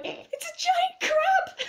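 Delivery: very high, loud, drawn-out squeal; high-pitched comic squeal, stretched out; amused mock shriek. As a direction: squeal it like a delighted, laughing mock scream. Amused retold reaction to film.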